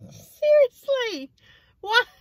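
very high, slow, drawn-out disbelief; incredulous. Real, not acted. Recalling the baffling friend, drawn-out high disbelief. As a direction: stretch it like you can't believe it.

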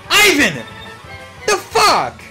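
high, loud cry, falling at the end; outraged yell. Real, not acted. Streamer yelling at a player mid-match, outraged yell. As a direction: yell in disbelief.

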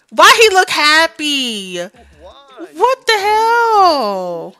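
high, drawn-out disbelief; slow, high-pitched bafflement; baffled outrage. Real, not acted. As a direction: stretch it out, incredulous. Baffled by character's happy look.

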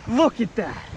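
ordinary delivery

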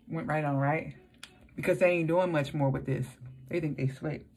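talking with clear braces; slight lisp from trays; aligners in mouth. Real, not acted. Seen 2: Just fitted aligners before; points at teeth showing them while talking.

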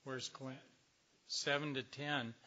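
normal talking voice

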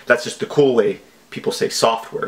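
normal talking voice

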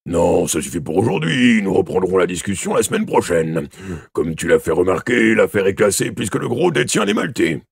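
forceful inspector's character voice; chief inspector, curt. Acted. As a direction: wind up the questioning with gruff authority. Chief inspector's speech in story.

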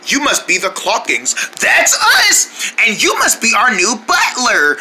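normal talking voice

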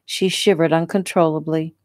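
ordinary delivery, nothing marked

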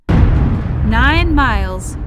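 loud and very slow; emphatic call. Acted. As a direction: draw out the warning. Grandma announces storm distance.

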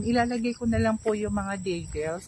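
normal talking voice

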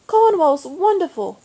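put-on a boy gushing with excitement; bubbling.

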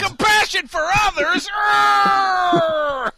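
high, loud pirate bellow; pirate shout. Acted. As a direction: roar it like a cartoon pirate. Mock pirate rant.

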